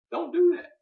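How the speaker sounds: mock scolding owner. Scolding the dog.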